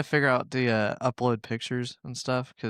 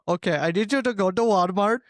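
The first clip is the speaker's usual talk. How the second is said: put-on higher Indian-accented call center voice; Indian telemarketer accent; 6 semitones higher than usual. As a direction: play an Indian phone scammer. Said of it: "Indian telemarketer".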